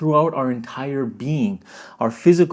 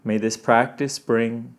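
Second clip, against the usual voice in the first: guided meditation; 4 semitones lower, 4 dB quieter than usual. Acted. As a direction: gently lead them inward. Guides intention setting.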